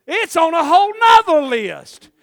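high, loud sermon testimony; impassioned preaching. Acted. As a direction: cry out the testimony to the congregation.

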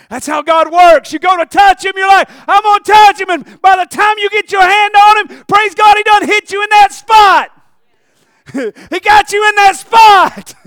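high, very loud sermon shout; full-force preaching. Acted. Preacher challenging church folk who sneak in, full-force preaching. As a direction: cry it out to the whole congregation.

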